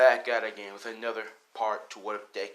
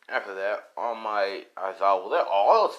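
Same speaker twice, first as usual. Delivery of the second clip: ordinary delivery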